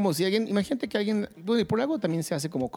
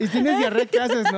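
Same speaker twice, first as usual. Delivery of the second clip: loud laughing quip; 7 semitones higher, 6 dB louder than usual. Real, not acted. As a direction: laugh through the punchline. Joking, laughing loudly.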